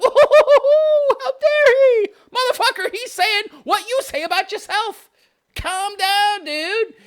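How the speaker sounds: squeaky high, loud mock outrage; mocking the offended writer. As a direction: ham up the whiny critic, very high and loud. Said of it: "And he's like".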